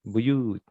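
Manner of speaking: "Buyut" with a long drawn "yuu"; long vowel. Shown as a demonstration. Teacher corrects a student, "Buyut" with its last vowel held long. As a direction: stretch the second vowel of "Buyut".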